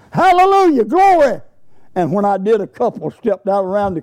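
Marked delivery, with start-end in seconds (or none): 0.1-1.4 loud, high shout of praise; reared-back hallelujah shout. Retold or imitated. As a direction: rear back and belt out the praise. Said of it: "I just reared back and said".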